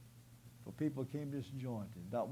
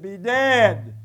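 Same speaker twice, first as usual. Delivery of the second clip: high, loud command; commanding shout; 8 semitones higher, 20 dB louder than usual. Acted. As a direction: order them dead with full force. Voicing Jesus's unused power.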